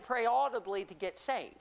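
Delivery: ordinary talk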